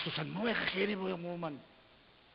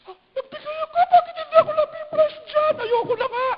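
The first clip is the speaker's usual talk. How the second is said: very high, loud whining voice; whiny complainer; 18 dB louder than usual. Retold or imitated. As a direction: whine like a sulking giver. Mocking disappointed giver. (mataas na pagmamaktol)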